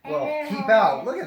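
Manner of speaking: laughing through the words; giggly, breaking into laughter. Real, not acted. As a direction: read it while cracking up. Reading scary sign, laughing.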